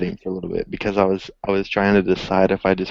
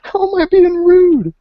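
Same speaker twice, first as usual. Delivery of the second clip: much higher, louder, slow squeal of outrage; outraged disbelief; 7 dB louder, slower than usual. Retold or imitated. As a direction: squeal it through laughter. Indignant retelling with laughter.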